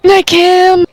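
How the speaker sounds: high, loud shout-out; calling out. Real, not acted. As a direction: call out goodnight loudly. Calling out to sleeping friend.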